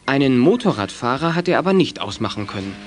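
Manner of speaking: usual speaking voice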